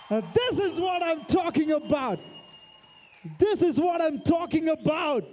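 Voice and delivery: thrilled shout. Real, not acted. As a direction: cheer the room on as the bid hits its peak. Bids reach one lakh.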